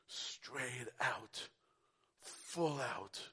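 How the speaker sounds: hushed awe over Jesus' tears; whispered. Real, not acted. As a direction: breathe it out reverently. Whispered solemn verse.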